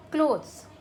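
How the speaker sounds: क्लोथ्स said loud, with the final s sounded clearly; teacher calls out क्लोथ्स, loud and firm, ending on a clear s; clothes with s. Shown as a demonstration. Teacher stressing the plural to the class, loud and clear. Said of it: "हमेशा उसमें ES का use होगा".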